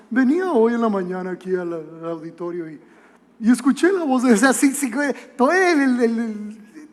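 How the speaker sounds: put-on swagger, petering out into stammers; boastful pastor. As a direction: lightly imitate a pastor showing off. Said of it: "pastores que fanfarronean".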